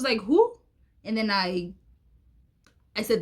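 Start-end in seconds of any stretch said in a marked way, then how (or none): 0.2-0.6 surprised who. Retold or imitated. Friend asked about the man.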